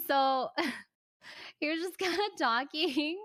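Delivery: words broken up by real laughter; laughing. Real, not acted. Laughing at her own story.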